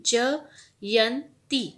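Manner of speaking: Jayanti stretched very slowly, syllable by syllable; very slow teaching demo of Jayanti; slow clear demo. Shown as a demonstration. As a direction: spell out Jayanti slowly for a child. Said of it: "जयंती का pronunciation हम कैसे बोलेंगे?".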